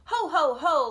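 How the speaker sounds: high, loud "ho, ho, ho"; loud mock laugh. Second pig jeering at his busy brother, loud laugh. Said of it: "laughed the second little pig".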